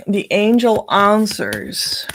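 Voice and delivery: usual speaking voice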